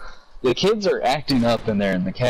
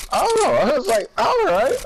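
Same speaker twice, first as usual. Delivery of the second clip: much higher surprised oh all right; mock surprise; 13 semitones higher than usual. Telling a friend about the jokes, high mock surprise. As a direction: squeak it like a startled realisation.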